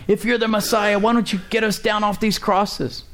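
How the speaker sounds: mocking criminals. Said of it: "they said things like".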